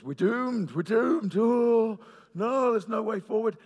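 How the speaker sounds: loud, high doom-laden wail; hammed-up cry of hopelessness, mimicking a panicked meeting; mock despair.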